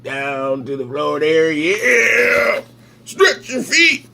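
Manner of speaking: rising into a high, drawn-out strain; playful straining. Pretend. Reader urging feet down to the floor, mock straining. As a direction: voice climbing as if mid-stretch.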